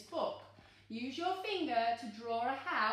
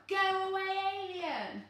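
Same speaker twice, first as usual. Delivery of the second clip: loud, higher mock-scary shout at the alien; 8 semitones higher, 5 dB louder than usual. Shown as a demonstration. Reader showing a child how to scare the alien, mock-scary shout. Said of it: "Make your scariest face and shout".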